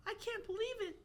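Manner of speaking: high, soft voice of awe; hushed falsetto, acting amazed; Alex. Alex seeing the new landscape, in awe. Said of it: "Alex said in awe".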